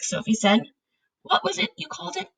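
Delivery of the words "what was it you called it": higher, softer and quick; a girl asking lightly; Sophie's voice. A narrator's reading. Sophie asks.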